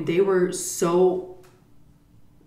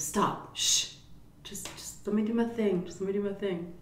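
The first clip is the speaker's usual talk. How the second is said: hushing; 3 semitones higher, 8 dB quieter than usual. Retold or imitated. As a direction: quietly wave off an objection. Shushing brother before the prank.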